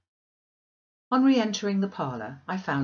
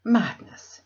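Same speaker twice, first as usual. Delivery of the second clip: loud, shocked outburst; Diana's loud horrified exclamation; exclaiming; 5 semitones higher, 8 dB louder than usual. Acted. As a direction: cry it out in alarm. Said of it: "she exclaimed".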